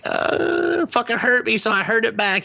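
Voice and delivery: high childlike mock voice; little girl voice. Charlie explaining to her dad, high child voice. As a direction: pitch way up like a bratty kid.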